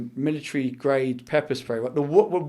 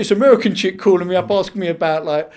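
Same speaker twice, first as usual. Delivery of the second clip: higher, louder London street lad; mock bewildered London mate; London street accent; 5 semitones higher, 7 dB louder than usual. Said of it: "boys from around the way going".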